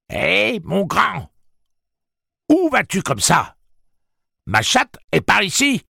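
very high, loud come-on; taunting call. A narrator's reading. Susanna luring the demon onto herself, taunting high shout. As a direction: holler a brazen lure at the top of your range.

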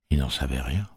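ordinary delivery, nothing marked

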